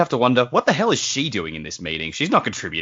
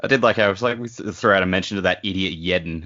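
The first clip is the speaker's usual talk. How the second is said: usual speaking voice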